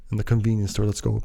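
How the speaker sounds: ordinary talk, nothing marked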